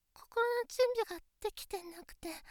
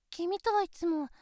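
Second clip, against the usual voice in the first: usual speaking voice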